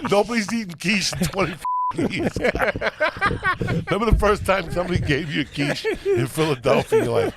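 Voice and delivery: ordinary delivery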